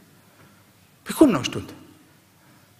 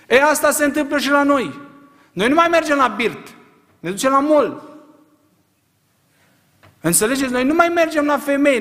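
full-voiced preaching, higher and louder; fiery preaching; 8 semitones higher, 4 dB louder than usual. Preacher rousing his congregation, full-force preaching. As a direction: preach it hard to the whole church.

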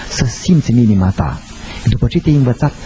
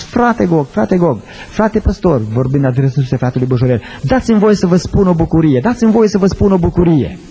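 put-on eager young man; 7 semitones higher than usual. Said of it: "a ridicat mâna în sus și a spus".

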